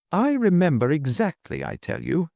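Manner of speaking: high, excited insistence; excited cry. A narrator's reading. Duke insisting on his memory, excited. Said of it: "cried the duke excitedly".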